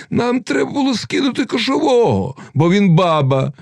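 crowd calling out. A narrator's reading. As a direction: call out a demand to the assembly. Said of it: "загукали запорожці".

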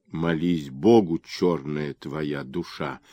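low, slow rasp; hoarse rasp. A narrator's reading. Axeman threatens the merchant at the noose, hoarse rasp. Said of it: "прохрипел дядя с топором".

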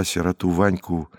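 plain reading voice

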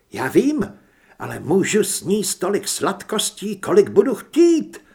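high and loud, bubbling over; half-laughing glee at the news; giddy delight. Acted. Heather brushing off murder, sweets.

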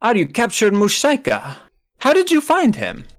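put-on German-accented question rising very high.

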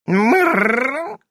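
rolled high purring cat sound. A narrator's reading. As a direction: purr like a cat.

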